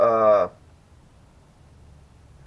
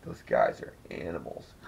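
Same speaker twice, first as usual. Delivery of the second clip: low, slow deadpan remark; lightly voiced Jeff; 11 semitones lower, 6 dB quieter than usual. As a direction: say it slowly and low, unimpressed. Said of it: "Jeff came into the room and was like".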